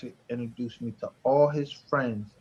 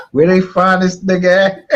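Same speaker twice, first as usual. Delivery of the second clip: louder and higher, talking through laughter; laughing disbelief; 6 semitones higher, 11 dB louder than usual. Real, not acted.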